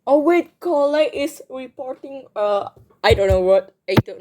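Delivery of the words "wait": normal talking voice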